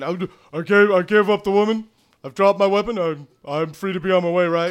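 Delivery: high, loud anxious bargaining; Thaddeus. Acted. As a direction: plead loudly to be let go. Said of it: "Thaddeus is tr-, saying".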